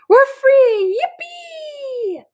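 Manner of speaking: a very high squeal of delight; joyful cheer. Acted. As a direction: shriek with glee. Dory celebrates guest leaving.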